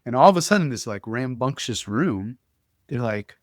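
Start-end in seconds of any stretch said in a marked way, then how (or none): none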